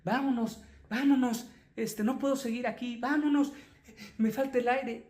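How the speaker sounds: anxious pleading. Retold or imitated. Patient begs husband to leave the party, anxious. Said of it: "empieza a sentir angustia y ansiedad".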